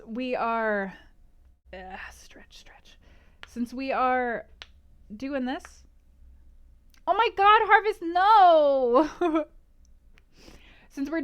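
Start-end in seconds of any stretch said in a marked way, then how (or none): 1.7-3.0 whispered stretch. Real, not acted. Quick stretch mid-stream. 7.1-9.4 loud, high, drawn-out no; shocked protest. Real, not acted. Viewer spoils again.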